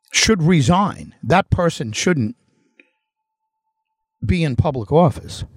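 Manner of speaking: usual speaking voice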